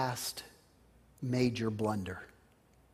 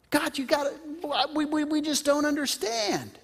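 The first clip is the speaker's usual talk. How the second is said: high, pleading prayer to God; Abraham praying; 9 semitones higher than usual. Retold or imitated. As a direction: plead with God, confused and anxious. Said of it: "and said".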